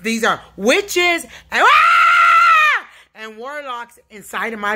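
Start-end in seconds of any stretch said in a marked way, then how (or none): 1.6-2.9 piercing high loud scream; sudden scream. Real, not acted. Jolted by something inside, sudden scream. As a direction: let out a sharp shriek. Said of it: "This yelling and screaming".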